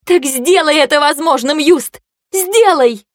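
high, ringing voice near tears; tearful plea. Acted. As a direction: beg him, close to crying. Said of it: "звенел от слёз".

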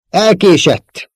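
high, loud, drawn-out call; urgent shout. A narrator's reading. Leila calling to Luke that it's too late, shouting. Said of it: "kiáltotta Leila".

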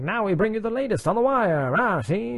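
fast, punchy vintage radio newsman; 1950s narrator. Shown as a demonstration. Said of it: "in, like, an old-timey news announcer voice".

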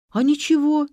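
ordinary delivery

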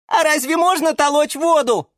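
puzzled little seedlings asking; surprised kids. A narrator's reading. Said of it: "дружно удивились малыши".

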